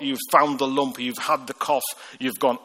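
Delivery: normal talking voice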